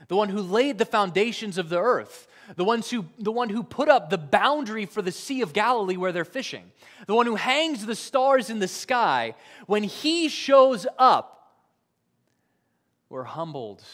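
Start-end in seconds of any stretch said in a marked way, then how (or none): 0.1-11.6 higher and louder, rising to a peak; preaching at full force; building preaching. Real, not acted. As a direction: swell toward the climax. Preaching God's greatness, building.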